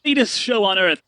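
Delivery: high, booming carnival barker; a grand ringmaster welcoming the crowd; showman merchant. Acted. Stanley greeting newcomers in a barker's voice.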